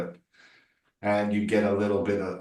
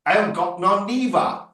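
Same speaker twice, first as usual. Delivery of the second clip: higher, put-on working-class British accent; 10 semitones higher than usual. Actor demonstrating a working-class Brit, working-class British accent. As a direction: do a rough-and-ready working-class British voice, "niva" for neither. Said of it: "playing a working class British person".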